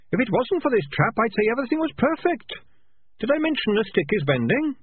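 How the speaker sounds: high, small, oddly calm rat; a tiny chirpy voice, unbothered; little rat voice. A narrator's reading. Sardines trapped.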